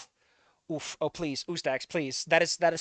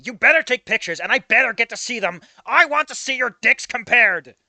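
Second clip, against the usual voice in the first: higher and louder, eager and pushy; egging him on, higher and louder; eager demand; 5 semitones higher, 8 dB louder than usual. Acted. Olivia eagerly demanding pictures.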